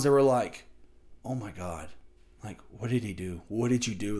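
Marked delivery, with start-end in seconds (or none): 1.2-4.2 low, hushed disbelief, barely raised; understated, quiet commentator reaction; calm commentary. Retold or imitated. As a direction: say it softly and low, subdued. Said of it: "they were so calm".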